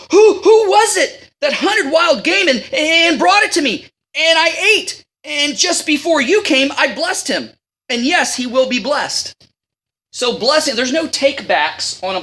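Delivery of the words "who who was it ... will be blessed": trembling. Retold or imitated. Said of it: "Isaac trembled with intense trembling".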